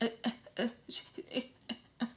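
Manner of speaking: soft, broken false starts; re-enacted speechless stutter; stuck stammering. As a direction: imitate choking on the first sound. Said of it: "literally like that".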